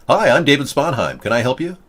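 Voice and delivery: ordinary delivery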